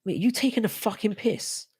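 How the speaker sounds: high-pitched, put-on domestic complaint; mock indignation. Streamer acting out living with a woman, mock indignant high voice. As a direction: act exasperated in a high voice. Acts out daily complaint at home.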